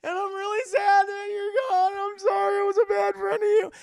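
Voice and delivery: very high, loud, hammed-up wailing; mock crying. Best friend mourning the fallen hero, hammed-up high sobbing wail. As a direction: bawl it out in an exaggerated squeal of grief. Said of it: "starts fucking crying".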